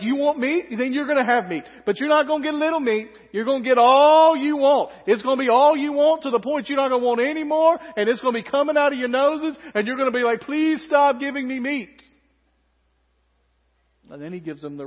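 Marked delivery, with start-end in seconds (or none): 0.0-12.0 loud, sarcastic parental scolding; fed-up parent. Retold or imitated. God as a fed-up parent telling the grumblers they'll get all they want, loud. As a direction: lecture them like a parent who has had enough. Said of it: "most parent-sounding times".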